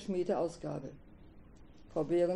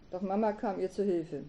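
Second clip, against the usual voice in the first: usual speaking voice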